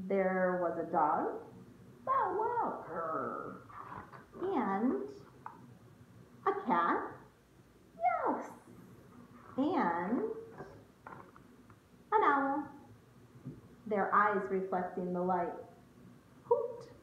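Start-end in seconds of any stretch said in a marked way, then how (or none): 2.1-2.8 a high, playful bow wow; cheerful high woof; high dog bark. Acted. Reading animal noises aloud. 2.9-3.6 low, soft cat purr; a quiet rumbly purr. Acted. Reading animal noises aloud. 8.0-8.4 very high, sweet cat meow; playful high mew. Acted. Reading animal noises aloud. 16.4-16.9 a high, playful owl hoot; sing-song hoot. Acted. Reading animal noises aloud.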